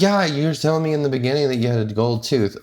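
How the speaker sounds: lightly voiced chatty stranger; passenger voice.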